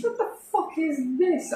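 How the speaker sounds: high, softly put-on bird character voice; puzzled pheasant. Curious pheasant finding a drunk in the woods, high puzzled voice. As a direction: squeak it as a baffled little creature.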